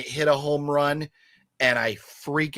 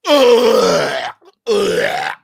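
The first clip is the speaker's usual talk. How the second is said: loud, higher, disgusted groans; 6 semitones higher, 11 dB louder than usual. Real, not acted. Host groans over a movie he is dreading, disgusted. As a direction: groan like the movie let you down.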